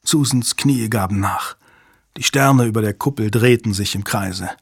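ordinary delivery

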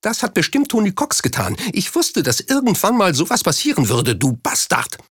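high, strained outburst at him; screamed accusation. A narrator's reading. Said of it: "schrie ihn an".

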